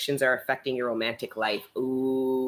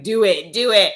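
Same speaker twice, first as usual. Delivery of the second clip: louder, higher mock chant urging it on; imitating viewers urging, louder; egging on; 6 semitones higher, 8 dB louder than usual. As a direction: cheer them on like a pushy friend. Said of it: "I hear you being like".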